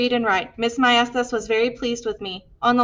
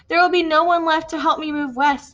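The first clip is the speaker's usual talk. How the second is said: ordinary delivery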